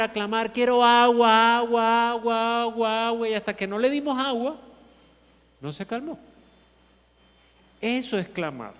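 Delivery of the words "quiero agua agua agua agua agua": a retold child's desperate cry for water, fading; mock wailing cry. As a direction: drag out a loud mock wail, then let it fade. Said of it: "comenzó a clamar".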